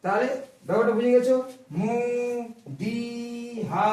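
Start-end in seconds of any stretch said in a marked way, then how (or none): 1.8-3.9 মু and দি each held long and slow; stretched syllables. Shown as a demonstration. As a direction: drag out মু, then দি, like a long vowel. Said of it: "টান হয়ে যাবে". (মু দি ধীরে টেনে টেনে বলা)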